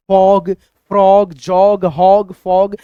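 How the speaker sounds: फॉग, फ्रॉग said slowly with a clear short "aw" vowel; model words. Shown as a demonstration. Teacher modelling short-vowel words for students, slow and clear. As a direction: pronounce each word slowly and carefully, like a model. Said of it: "जैसे".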